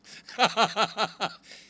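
loud burst of chuckling; amused laugh. Real, not acted. Preacher recalling the last mocking wheelchair, laughing. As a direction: laugh out at your own story.

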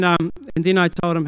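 usual speaking voice

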